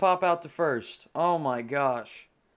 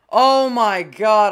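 loud, drawn-out real exasperation; exasperated groan; 5 semitones higher, 11 dB louder, much slower than usual. Real, not acted. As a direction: stretch it out in disbelief. Despair after losing.

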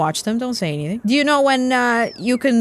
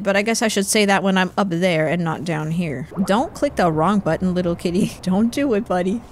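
ordinary talk

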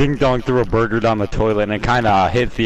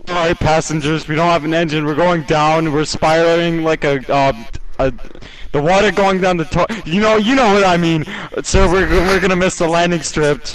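panicked captain announcement; 5 semitones higher, 4 dB louder than usual. Pretend. Captain announces crash to passengers.

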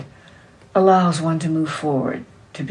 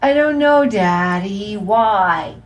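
put-on little girl; 4 semitones higher, 5 dB louder, slower than usual. A narrator's reading. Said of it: "she said again".

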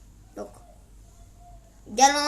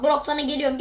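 usual speaking voice

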